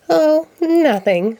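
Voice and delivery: Oh nothing, drawn out high and slow; Big Bird pretending nothing is up; slow, high, innocent fib. A narrator's reading. Big Bird hiding a secret.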